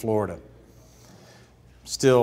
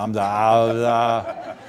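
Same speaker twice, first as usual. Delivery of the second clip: normal talking voice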